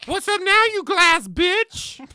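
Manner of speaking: taunting. Acted. Character taunting at gunpoint.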